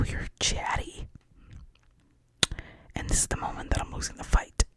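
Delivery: hushed, unvoiced storytelling; whispered movie recap; whispered retelling. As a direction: tell it all in a whisper.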